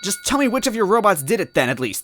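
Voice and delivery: put-on high, loud desperate girlish yell; Toadette yelling. Toadette yelling at Smithy over her brother. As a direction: cry out for her lost brother.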